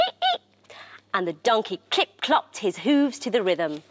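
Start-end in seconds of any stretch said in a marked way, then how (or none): 0.1-0.7 tiny, very high squeaks; short imitated mouse noises. A narrator's reading. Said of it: "And the mice squeaked".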